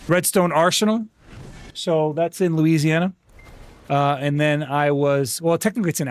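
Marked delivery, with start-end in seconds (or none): none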